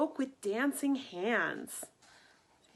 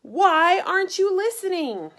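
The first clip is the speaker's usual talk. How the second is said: loud, high exasperated yell; angry shout; 8 semitones higher, 10 dB louder than usual. Acted. King shouting at Boy who won't listen, loud yell. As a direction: shout it in frustration. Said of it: "shouted the king".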